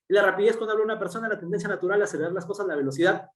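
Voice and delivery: rapid-fire, words run together; too fast. Shown as a demonstration. Teacher showing students how speeding up loses listeners, very fast. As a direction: race through it so it is hard to follow. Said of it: "no me entendieron mucho".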